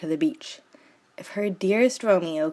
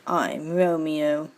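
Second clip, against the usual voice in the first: slow, lower suave self-introduction; dashing voice; 3 semitones lower, slower than usual. Acted. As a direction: introduce yourself like a charming hero. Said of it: "said Romeo in his best dashing voice".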